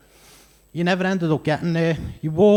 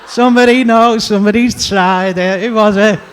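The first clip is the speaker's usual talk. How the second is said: much louder and higher, riding the crowd; loud excited outburst; 5 semitones higher, 9 dB louder than usual. Real, not acted. Comic reacting to a cheering crowd, loud and excited. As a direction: shout it over the audience's cheering.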